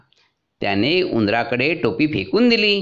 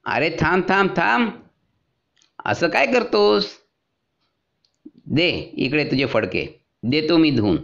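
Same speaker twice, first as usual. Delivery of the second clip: washerman giving in; 4 semitones higher than usual. A narrator's reading. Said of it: "धोबीदादा म्हणाला".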